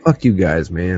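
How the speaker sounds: very soft, low, emotional; brushing it off through tears; choked up. Real, not acted. Friend waving off his buddies while choked up, very soft.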